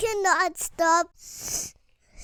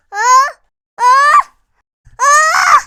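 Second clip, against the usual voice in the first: rising, louder gasps before a sneeze; sneeze building; 8 semitones higher, 18 dB louder than usual. Acted. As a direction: gasp up toward a big sneeze.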